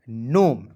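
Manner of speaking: नोम with the g silent, "nome"; correct pronunciation. Shown as a demonstration. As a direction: drop the g and say नोम. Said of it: "जी को silent कीजिएगा". (सही उच्चारण: नोम, जी silent)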